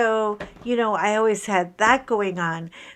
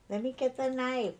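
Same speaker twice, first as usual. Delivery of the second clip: mock killer; 3 semitones higher, 8 dB quieter than usual. Woman mocking her own killer-like remark, mock killer. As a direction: repeat it as a make-believe killer. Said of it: "I sound like such a killer".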